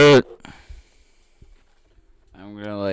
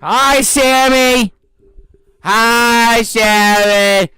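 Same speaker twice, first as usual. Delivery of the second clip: loud, high, drawn-out greeting; shouting capitalized words; 11 dB louder, much slower than usual. Pretend. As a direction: shout it like all caps. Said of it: "Sammy typed in all caps, so we have to reply as such".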